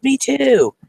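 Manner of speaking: high, eager chiming in; excited agreement. Retold or imitated. Agreeing with a new concert friend, excited. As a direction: jump in as a fellow fan.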